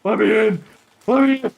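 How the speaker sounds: high, loud begging voice; whiny pleading yell. Pretend. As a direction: beg loudly to be let in. Mock pleading at locked door.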